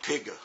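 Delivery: imitating a teacher saying "Pigga", hard g plus extra "uh"; "Pigga" with an added vowel after a hard g; teacher's mispronunciation. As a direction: turn pig into two syllables, "Pigga". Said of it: "they use these consonants really hard".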